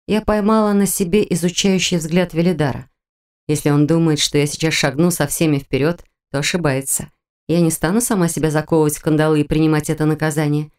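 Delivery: ordinary delivery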